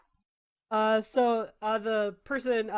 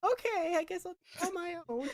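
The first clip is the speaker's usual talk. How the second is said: high, soft, quick grumble; sulky villager; 8 semitones higher, 4 dB quieter than usual. Acted. The rebuffed villager grumbles at the party, high and sulky. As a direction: sulk off muttering to yourself.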